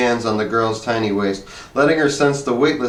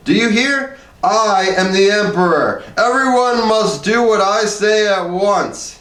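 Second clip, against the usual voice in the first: higher, slow imperious shout; commanding cry; 9 semitones higher, 3 dB louder than usual. A narrator's reading. Harrison commands the cowering studio, higher and slow. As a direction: order everyone like an emperor. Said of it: "cried Harrison".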